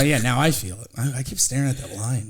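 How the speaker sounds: normal talking voice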